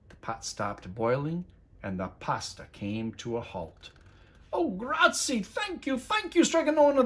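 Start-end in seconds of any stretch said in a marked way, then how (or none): 4.5-7.0 high, relieved thanks; grateful cry. A narrator's reading. The people thank Stregonona, high relieved cries. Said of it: "the people cried".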